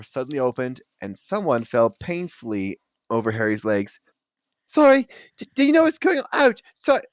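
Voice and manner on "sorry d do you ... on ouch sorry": high, loud, flustered apology. Acted. Neville tripping over Harry's legs, high and flustered. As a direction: blurt it out after tripping.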